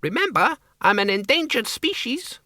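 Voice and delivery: high squeaky bat voice; tiny high-pitched squeaking protest. Acted. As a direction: squeak it like a frightened little bat. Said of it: "the Count squeaked".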